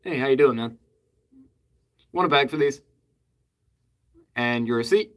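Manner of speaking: casual, unfussy everyday cashier tone; flat rote cashier. Shown as a demonstration. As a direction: say it like a bored regular cashier. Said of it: "that is pretty much exactly how I say it".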